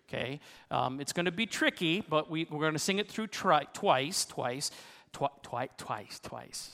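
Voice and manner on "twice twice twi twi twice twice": nervous. Real, not acted. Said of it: "I'm getting nervous".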